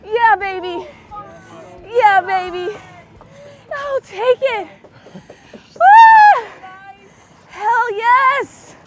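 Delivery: loud, high victory shouts; excited cheer. Real, not acted. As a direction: celebrate the catch out loud. Celebrating the catch.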